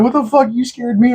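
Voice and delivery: high mock freakout. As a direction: mimic him panicking in a thin high voice. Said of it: "He's like".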